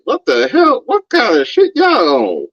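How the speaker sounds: high shocked what the hell; incredulous outburst. Real, not acted. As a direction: pitch jumps way up in disbelief. Shocked reaction to every experience.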